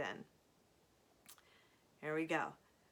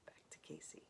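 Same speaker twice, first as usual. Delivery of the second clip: whisper; 22 dB quieter than usual. Real, not acted. Whispered aside between chapters.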